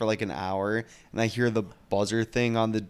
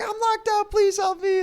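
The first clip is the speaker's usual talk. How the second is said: shrieking far higher, louder plea; mock scream crying; 5 dB louder than usual. Locked-out girl pleading up at the slammed window, shrill mock wail. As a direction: howl it like a hysterical stranded girl. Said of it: "keeps on, like, scream crying".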